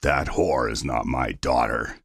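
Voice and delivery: very deep, slow, menacing father; low, angry growl. A narrator's reading. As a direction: growl your contempt slowly. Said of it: "he growls".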